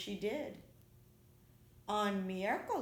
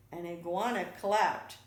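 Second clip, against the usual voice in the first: plain reading voice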